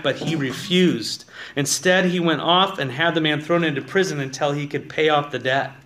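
loud, driving reading of the parable; forceful scripture reading. Acted. Pastor reads the parable to his congregation, loud preaching. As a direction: read it out like you're preaching to the back row.

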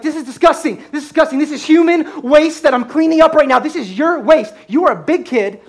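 high, loud disgusted rant; freaking out. Retold or imitated. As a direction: rant at the kid while cleaning up. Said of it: "I am freaking out".

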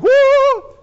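very high, loud mock shriek; startled yelp. Mary is surprised by Gabriel, yelping in mock alarm. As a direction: jump out of your skin on one word. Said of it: "She's like".

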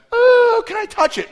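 put-on loud high-pitched kid squeal; excited kids. Kids see the huge knife, loud excited squeal. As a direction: imitate children thrilled and begging.

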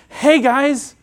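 a bright friendly greeting, calling out; cheerful hello. Retold or imitated. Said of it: "He says".

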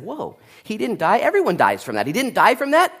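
high, rising disbelief; astonished. Acted. Locals seeing Paul survive the snake, astonished. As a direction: react in mock amazement.

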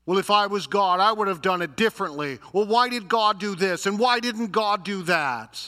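loud, high griping; hammed-up indignant complaint; mock complaining. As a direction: imitate someone griping about how things are run. Acts grumblers questioning God.

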